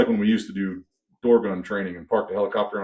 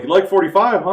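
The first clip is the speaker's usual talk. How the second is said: knowing question; 9 semitones higher, 7 dB louder than usual. Retold or imitated. Telling the host he clearly likes forty-fives, knowing tease. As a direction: poke at him knowingly about the forty-fives. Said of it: "And I was like".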